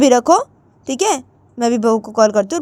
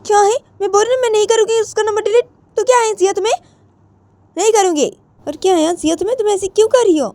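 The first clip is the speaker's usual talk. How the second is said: voiced phone argument, raised and high; voiced quarrel; 12 semitones higher, faster than usual. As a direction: push back hard in a young pleading voice. Mother and daughter quarrel.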